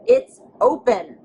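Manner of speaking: usual speaking voice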